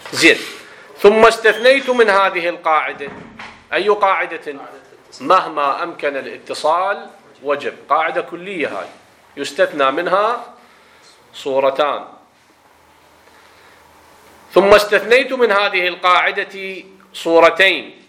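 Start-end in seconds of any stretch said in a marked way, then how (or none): 1.0-3.1 loud, higher formal reading; reading aloud. Acted. Teacher reading out the exception, loud formal reading voice. Reads the next textbook sentence. 14.5-18.0 loud, higher formal textbook reading; reading aloud. Acted. Teacher rereading the exception to students, loud formal reading voice.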